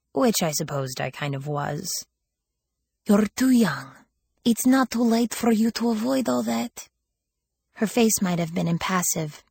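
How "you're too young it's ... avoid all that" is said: higher voice with a touch of sadness; sad, accented. A narrator's reading. Older woman warning a young girl away, sadly. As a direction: plead gently through a thick accent. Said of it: "there was sadness in her voice".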